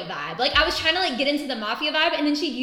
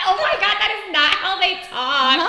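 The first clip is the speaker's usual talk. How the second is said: high laughing outburst; 6 semitones higher, 6 dB louder than usual. Real, not acted. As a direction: laugh through the words. Laughing at stereotype.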